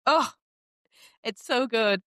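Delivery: ordinary talk, nothing marked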